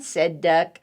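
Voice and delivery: plain reading voice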